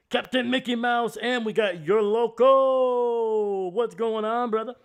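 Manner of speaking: high, loud, stretched-out greeting; excited shout-out. Real, not acted. Streamer hyping up a chat viewer, loud excited greeting. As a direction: belt out a welcome to the viewer.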